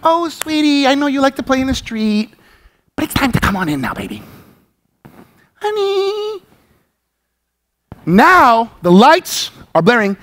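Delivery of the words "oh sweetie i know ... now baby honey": mock sweet parent. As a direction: sweet-talk the toddler, too gently. Parent coaxing child from street.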